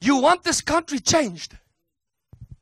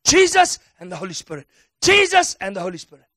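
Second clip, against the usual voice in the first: fast emphatic repetition; 2 semitones lower, 5 dB louder, faster than usual. Acted. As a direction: rattle it off quickly and firmly, louder. Repeated rapid chant.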